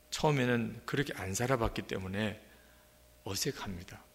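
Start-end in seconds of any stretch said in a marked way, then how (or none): none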